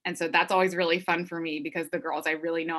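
usual speaking voice

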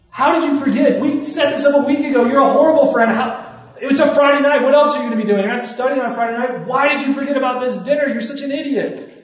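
loud, high-pitched acted tirade; shaming scolding. Friend berating someone who forgot dinner plans, angry shaming. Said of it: "use shame to really make you feel bad".

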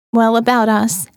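usual speaking voice

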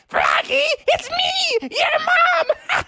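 very high cackling witch voice; cackling witch mom. Acted. Mom calls Froggy outside his window, cackling like a witch. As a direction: shriek it between witchy cackles. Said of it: "he heard his mom's voice going".

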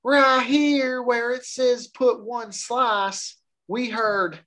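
put-on high, slow old lady voice; older woman impression. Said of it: "do my best older woman voice".

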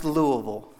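Louisville run together as "LOO-uh-vul"; local Louisville pronunciation. Shown as a demonstration. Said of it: "it's pronounced".

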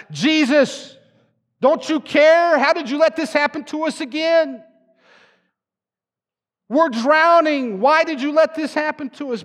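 high, desperate wail at Jesus; crying out. Acted. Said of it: "cried out".

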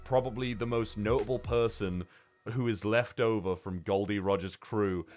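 normal talking voice

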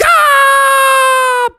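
shrill scream as he drops; high falling scream. Acted. Bubby falling from the broken branch, screaming. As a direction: yell like the branch just snapped.

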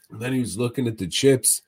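ordinary talk

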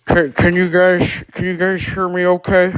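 heavy breaths between words; heavy nose breathing. Real, not acted. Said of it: "quit breathing into the mic".

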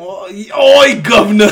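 loud, hammed-up Cockney shout; rowdy put-on Cockney accent; mock Cockney. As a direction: holler like a cartoon Cockney.

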